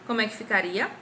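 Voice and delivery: ordinary talk, nothing marked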